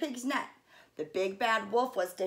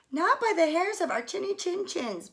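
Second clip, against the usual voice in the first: higher squeaky pig reply; defiant squeal; 8 semitones higher, 3 dB louder than usual. A narrator's reading. Pigs replying to the wolf, higher. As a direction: stand firm like little pigs. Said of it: "the pigs replied".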